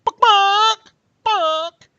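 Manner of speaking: high, loud, drawn-out squawks; yelling chicken. Acted. Chicken flinging books into the swamp, yelling in squawks. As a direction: squawk furiously like a chicken hurling things. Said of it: "the swamp while yelling".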